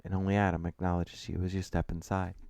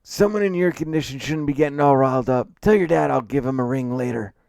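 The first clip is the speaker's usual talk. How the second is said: raised, loud character voice; cantankerous client Mister McCrae, dismissive; 7 semitones higher, 8 dB louder than usual. Acted. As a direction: brush him off loudly as the client.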